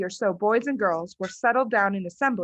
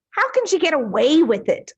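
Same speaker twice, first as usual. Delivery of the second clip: put-on higher, louder girl's voice, alarmed; worried schoolgirl; 7 semitones higher, 7 dB louder than usual. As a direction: ask it like a scandalised child.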